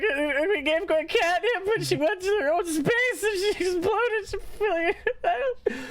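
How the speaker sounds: squeaky, tearful guilty confession; Gwen sobbing. Acted. GM as Gwen confessing to Friggs, high tearful voice. GM voices crying cat NPC.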